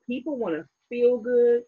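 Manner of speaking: usual speaking voice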